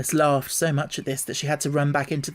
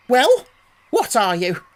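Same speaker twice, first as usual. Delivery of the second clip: high, loud snapping question; impatient bird voice; sharp pigeon demand; 8 semitones higher, 8 dB louder, slower than usual. A narrator's reading. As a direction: demand an answer at once.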